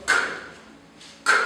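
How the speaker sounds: "K" as a short unvoiced puff; hard K sound. Shown as a demonstration. Teacher demonstrating the K sound again. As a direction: breathe out a crisp "K". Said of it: "it's like K".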